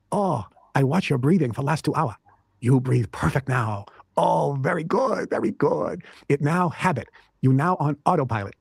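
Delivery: lightly imitated Chinese accent, slow and pleased; a gentle impression of a Chinese master praising in a Chinese accent; Grandmaster Tai's Chinese accent. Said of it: "he spoke with a pretty heavy accent".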